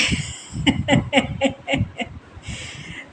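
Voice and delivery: long breathy giggle; helpless run of giggles; real giggling fit. Real, not acted. Laughs at repeated slip.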